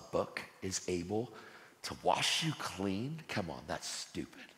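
a hint of a low, soft sneering devil; devil's taunt. The devil taunting the worthless sinner, low and sly. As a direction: murmur the lies low and mocking. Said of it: "the devil loves to answer".

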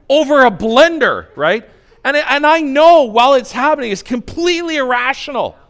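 loud, high, heated preaching; worked-up rant. Real, not acted. Preacher ranting to the congregation about his blender fights, heated and loud. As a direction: build it up into an exasperated peak.

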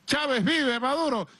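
high mock cheer; imitated calling out. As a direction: mimic people hailing you with a slogan. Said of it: "le dice".

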